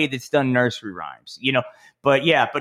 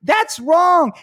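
very high, loud heroic yell; superhero shout; 5 dB louder, slower than usual. Acted. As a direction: swoop in and stop her with a cartoon shout. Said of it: "He comes down".

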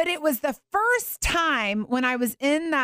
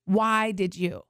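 slow accusing question; 5 semitones lower, slower than usual. Retold or imitated. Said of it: "pointed my finger at the Lord and said".

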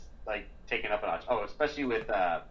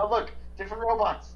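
mock happy robot; 9 semitones higher than usual. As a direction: squeal it like a relieved cartoon robot. Voicing robots in movie.

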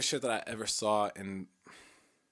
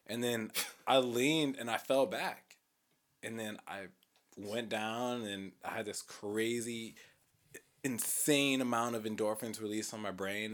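usual speaking voice

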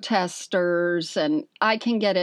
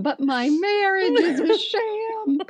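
laughing punchline; 11 semitones higher than usual. Real, not acted.

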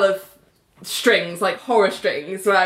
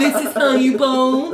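mock mansplainer; 5 semitones higher, 4 dB louder than usual. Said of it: "me being like".

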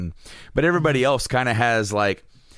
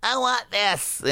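put-on high-pitched kid demanding a toy; whiny child begging; 13 semitones higher, slower than usual. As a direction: imitate your kid self wanting it badly. Acts out begging child self.

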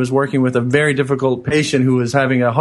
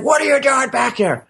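much higher annoyed demand; irritated challenge; 10 semitones higher than usual. Retold or imitated. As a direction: snap at him, higher. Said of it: "the guy's like".

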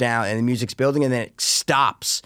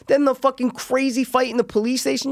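usual speaking voice

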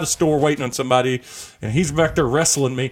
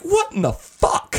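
much higher, slow, stunned disbelief; stunned outrage; 14 semitones higher, slower than usual. Retold or imitated. Husband reacting to his wife's punch, high stunned outrage. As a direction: stretch it out in shocked disbelief. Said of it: "And I was like".

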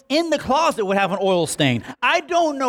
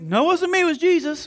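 mock pious husband; 9 semitones higher, faster than usual. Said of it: "your wife goes".

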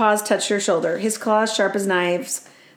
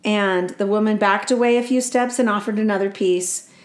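ordinary delivery

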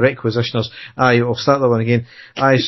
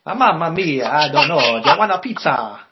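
a hammed-up Italian accent, pitched up; a mock Italian, a touch higher; 4 semitones higher than usual. Joking about an Italian accent on a football podcast, in a hammed-up mock Italian accent. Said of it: "Didn't put your Italian accent on".

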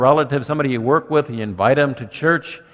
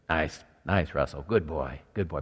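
much softer and lower, gentle coaxing; soft soothing to a dog; 8 semitones lower, 9 dB quieter than usual. Retold or imitated. Man soothing his hyper dog Russell, soft and gentle. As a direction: hush a trembling dog calm. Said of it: "whisper in his ear and talk softly to him".

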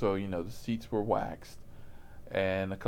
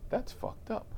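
stunned whisper; 10 semitones higher, 7 dB quieter than usual. Retold or imitated. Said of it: "he's stunned".

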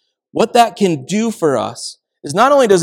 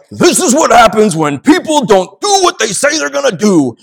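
higher, louder frustrated lecture; angry rant; 7 semitones higher, 7 dB louder than usual. Retold or imitated. Dad venting at his sons in the car, loud and angry. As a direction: vent at the boys about unreliable people. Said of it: "And I was like".

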